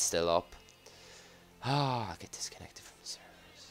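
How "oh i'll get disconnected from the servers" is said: whispering. Real, not acted. Fearing a server disconnect.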